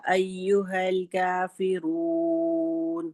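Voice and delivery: slow, measured chanted recitation; Quran recitation. Shown as a demonstration. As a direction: recite it slowly for students to copy. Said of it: "سنیے میں کیسے پڑھ رہی ہوں".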